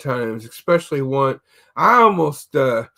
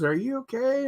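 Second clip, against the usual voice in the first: concerned associate; 8 semitones higher, 6 dB quieter than usual. Retold or imitated. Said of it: "an associate goes up to me and goes".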